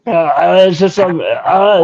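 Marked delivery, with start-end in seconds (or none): none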